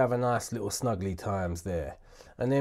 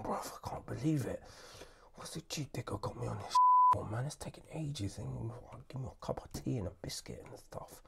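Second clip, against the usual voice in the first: softer, hushed complaint to himself; whispered grumble; 9 dB quieter than usual. Real, not acted. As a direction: mutter it under your breath, fed up. Whispered moan about tedious task.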